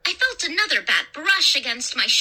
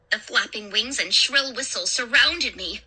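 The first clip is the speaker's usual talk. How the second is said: ordinary delivery, nothing marked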